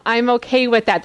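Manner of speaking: ordinary talk, nothing marked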